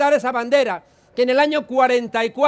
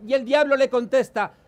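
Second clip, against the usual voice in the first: ordinary delivery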